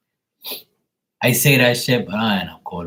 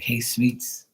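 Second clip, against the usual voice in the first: imitating brother-in-law; 3 semitones higher than usual. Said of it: "He's like".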